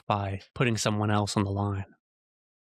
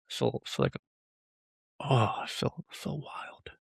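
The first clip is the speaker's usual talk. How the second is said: soft, whispery, winded by emotion; heart pumping; 6 dB quieter, slower than usual. Real, not acted. Speaker coming down from a rant on love, hushed and spent. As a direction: let it fall away into breath. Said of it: "Gosh, my heart is pumping".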